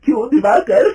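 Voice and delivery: loud, high, shouting accusation; mother-in-law shouting at the manager who cheated her; furious demand. A narrator's reading. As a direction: confront the cheating manager at full voice. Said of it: "गुस्से से दिवाकर को पूछती है".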